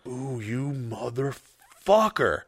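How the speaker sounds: slow, drawn-out mock menace; seething curse. Tasting his own blood in a fight, slow seething curse. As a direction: stretch the curse out slowly. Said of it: "when you taste your own blood".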